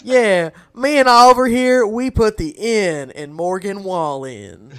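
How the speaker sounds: lightly hammed-up stage boast, starting loud and high; mock country showman. Joking as a touring duo partner, mild showman boast. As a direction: play a boastful country act, easing off.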